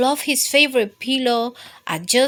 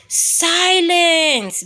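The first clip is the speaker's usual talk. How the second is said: loud roared silence; bear roar; 9 semitones higher, 7 dB louder than usual. A narrator's reading. Bear roaring at noisy Mouse, loud shout. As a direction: bellow it. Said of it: "Bear roared".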